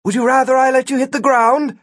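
high-pitched yelled retort; yelling back. A narrator's reading. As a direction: call it back across a distance. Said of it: "Gwen yelled back".

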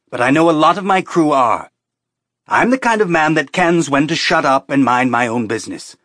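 heated captain drawing a hard line; exasperated captain. A narrator's reading. Captain warns stowaway he may lock her up, exasperated.

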